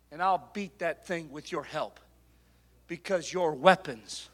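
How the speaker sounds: ordinary talk, nothing marked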